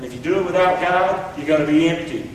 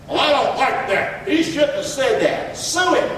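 higher, slow voice of an objector; hammed-up grumbling, pitch climbing at the end; mock complaint; 5 semitones higher than usual. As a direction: imitate someone indignantly objecting. Voices objectors to God's authority.

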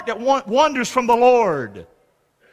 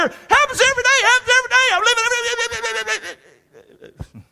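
much higher, ecstatic fading into a laugh; mock zealot; 12 semitones higher, faster than usual. Preacher mocking the everyday-miracle camp, high and giddy. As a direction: ham up the giddy believer who sees miracles daily. Voicing the super-spiritual camp.

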